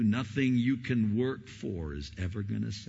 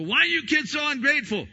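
high, loud mock exasperated parent; a fed-up parent scolding the kids; 8 dB louder, faster than usual. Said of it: "You go".

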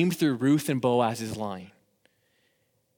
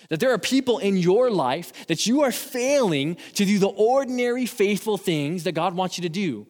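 higher, louder impassioned preaching; rousing youth sermon, pitched up and pushed hard; preaching at full force; 6 semitones higher, 4 dB louder than usual. Acted. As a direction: fire up the high schoolers to go and act. Youth pastor preaching, building up.

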